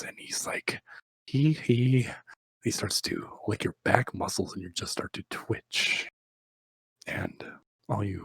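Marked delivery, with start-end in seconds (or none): 1.3-2.1 high imitated pop-star giggle; mock hee-hee. [name] giggle imitated.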